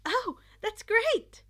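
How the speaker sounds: high, loud, slow happy exclaim; delighted. A narrator's reading. Izuku delighted that Kaminari did well, loud and happy. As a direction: beam with joy at the news.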